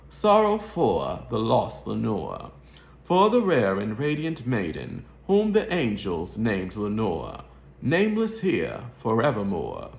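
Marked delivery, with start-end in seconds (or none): none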